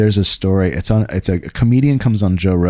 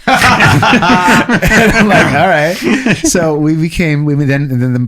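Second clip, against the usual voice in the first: laughing; 8 semitones higher, 5 dB louder, slower than usual. Real, not acted. Laughing at owner's trick.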